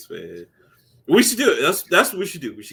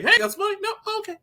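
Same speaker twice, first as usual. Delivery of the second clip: high, breezy ask, then easy give-up; cheerful bum asking for change; mock panhandler; much faster than usual. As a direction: chirp the ask and let it go lightly. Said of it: "come out every now and then like".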